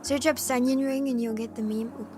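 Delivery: ordinary talk, nothing marked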